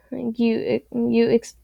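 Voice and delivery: ordinary delivery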